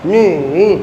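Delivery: नी dipping low then rising; teacher demo of नी; third tone. Shown as a demonstration. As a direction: sound out नी down and up. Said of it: "ली".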